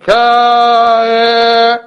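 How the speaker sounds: held high trumpet tone; sung trumpet call. Shown as a demonstration. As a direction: sing it like a trumpet sounding. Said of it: "Voice like trumpet".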